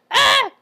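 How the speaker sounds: high, loud shriek; frightened scream. Acted. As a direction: scream as if just caught. Caught hiding by the tiger.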